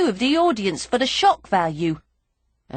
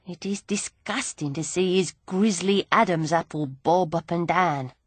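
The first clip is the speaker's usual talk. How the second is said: ordinary delivery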